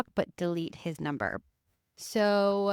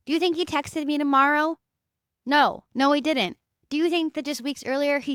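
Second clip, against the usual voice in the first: louder, higher rhetorical outrage; higher and louder, scoffing no; indignant rhetorical; 7 semitones higher, 4 dB louder than usual. Real, not acted. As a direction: hammer the answer home, exasperated. Indignant rhetorical question and answer.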